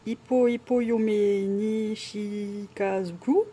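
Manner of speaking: slow, halting Japanese in a French accent; clumsy Japanese accent. Real, not acted. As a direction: sound out unfamiliar Japanese syllable by syllable, French-accented. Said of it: "je suis désolée avec mon accent". (japonais hésitant, accent français)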